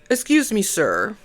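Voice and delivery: retold soldier's polite address, loud then hushed; imitating a crisp military voice, lightly done. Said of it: "he said it in a very, uh, military voice".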